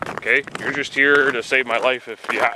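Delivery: ordinary talk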